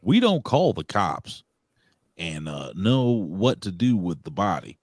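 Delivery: badly faked, slow Southern drawl; Southern accent. Host reading Winona's comment aloud, badly faked Southern accent. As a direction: read it out in a hammed-up Southern accent. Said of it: "gonna read it with a Southern accent".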